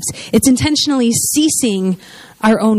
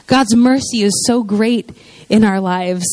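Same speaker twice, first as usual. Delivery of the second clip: ordinary talk, nothing marked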